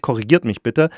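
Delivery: ordinary talk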